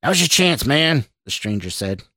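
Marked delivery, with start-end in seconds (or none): 0.0-1.0 higher, louder urging; urgent goading. A narrator's reading. Stranger urges Scott to attack boss, urgent. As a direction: push him to act right now.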